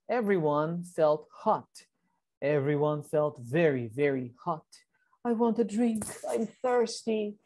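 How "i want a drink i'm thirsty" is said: high, plaintive little voice; thirsty character. A narrator's reading. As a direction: plead high, parched for a drink. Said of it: "said Keeper".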